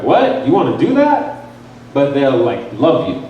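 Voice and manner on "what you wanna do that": mock shocked disbelief. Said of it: "faint and be like".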